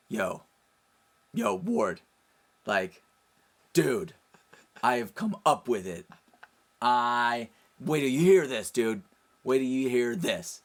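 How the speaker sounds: loud, high, worked-up bursts; excited reveal. Retold or imitated. Friend bursting to share his brand idea, excited. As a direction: build up the big reveal, punchy and loud.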